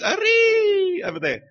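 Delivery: "Arri" held long on a very high note; Malagasy distance stretch. Shown as a demonstration. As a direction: show far distance by stretching the REE of "Arri" high and loud. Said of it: "how they describe distance in Malagasy".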